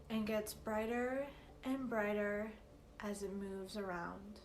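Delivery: slow, measured meditation guide with long pauses; unhurried, evenly paced calm guidance; guided meditation. Acted. Teacher leading listeners through a meditation for confidence, slow guided-meditation delivery.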